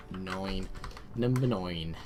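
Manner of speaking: a touch of Australian on the vowel of "nine"; slightly Australian. Real, not acted. Player numbering a unit, slightly Australian accent. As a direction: slip into a lightly Australian "nine". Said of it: "a bit Australian".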